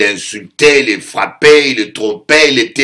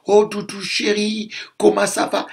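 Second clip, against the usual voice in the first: higher, softer, sweet-talking; a gentle wife sweet-talking her husband; cooing wife; 8 semitones higher, 7 dB quieter than usual. Retold or imitated. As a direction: coo to him like a doting wife. Imitates gentle metropolitan wife.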